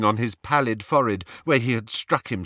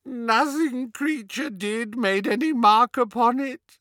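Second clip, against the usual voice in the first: moaning house-elf; 11 semitones higher than usual. A narrator's reading. Elf confessing his failure to Harry, moaning. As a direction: moan it in misery. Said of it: "moaned the elf".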